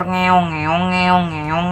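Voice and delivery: mocking meows, like an alley cat; taunting cat noises; mock cat meowing. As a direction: meow it out to ridicule the trolls. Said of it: "nggak jelas".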